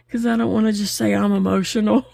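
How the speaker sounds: talking through a laugh, quickly; half-laughing it out. Real, not acted. Telling viewers she won't just admit emotion, laughing.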